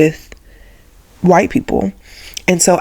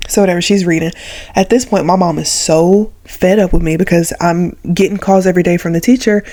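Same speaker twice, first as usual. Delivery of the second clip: ordinary delivery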